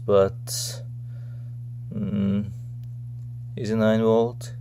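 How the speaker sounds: ordinary delivery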